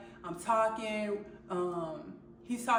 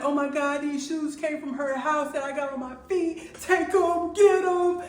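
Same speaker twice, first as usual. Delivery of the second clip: higher, louder frantic pleading; frantic panic; 6 semitones higher, 7 dB louder than usual. Retold or imitated. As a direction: beg in a rising panic, getting louder. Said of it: "I'm crying, I'm freaking out".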